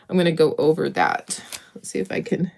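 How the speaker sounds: normal talking voice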